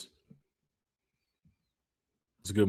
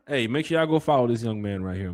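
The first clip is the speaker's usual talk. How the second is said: ordinary delivery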